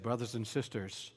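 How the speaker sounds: soft, low, broken apology; humble plea. Retold or imitated. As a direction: beg forgiveness quietly. Elder apologizes to congregation.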